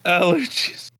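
high, drawn-out insistence; insistent repeat. Real, not acted. Repeats his correction after teasing.

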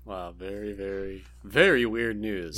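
deadpan. Pretend. Deadpan comment on the news.

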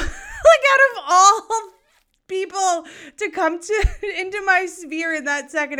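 high, loud, words swamped by laughter; helpless real laugh through the words; laughing hard. Real, not acted. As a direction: crack up while trying to speak. Laughs retelling chance encounter.

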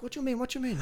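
very high-pitched puzzled protest; mock bewilderment in a high voice; imitated baffled masseur. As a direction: mimic the masseur, squeaking the question twice.

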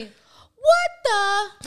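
high, loud cut-off exclamation; a little girl starting a curse, high and loud; toddler exclaiming. Retold or imitated. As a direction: exclaim and stop short. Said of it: "Brooklyn say".

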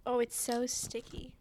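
slow, breathy ASMR whisper into the mic. Pretend. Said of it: "That's some A S M R".

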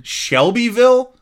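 high, loud, drawn-out surprised question; excited surprise. Real, not acted. Podcaster spots a familiar town on the list, excited surprise. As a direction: burst out in thrilled disbelief, pitch leaping up. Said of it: "I got excited".

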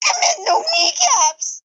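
laughing shriek. Acted. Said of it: "[name] shrieked".

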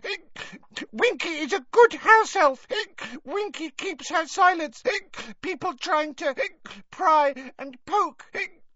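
a tipsy elf defending her master's secrets; indignant hiccuping house-elf; ranting drunk elf. A narrator's reading. Winky drunk rant.

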